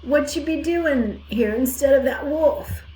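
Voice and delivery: put-on old ewe asking, slow and rustic; hoarse old ewe. A narrator's reading. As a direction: drag it out as a suspicious old sheep. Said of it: "Ma's hoarse voice".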